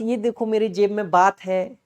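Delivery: usual speaking voice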